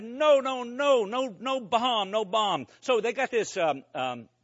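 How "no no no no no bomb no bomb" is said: retold frantic denial. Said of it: "So I said".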